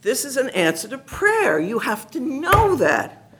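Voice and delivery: high-pitched, exasperated insistence; insistent pleading. Retold or imitated. Teacher urging the nun to see answered prayer, high insistent plea.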